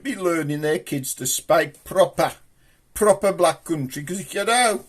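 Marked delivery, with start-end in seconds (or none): none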